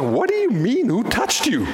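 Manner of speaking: high, incredulous New York voice; New York accent. Acted. Disciples turning round to question Jesus, incredulous New York accent. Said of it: "with my New York accent".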